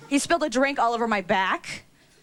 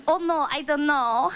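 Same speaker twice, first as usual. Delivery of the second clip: fake no-English; 3 semitones higher than usual. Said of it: "pretend you don't speak English".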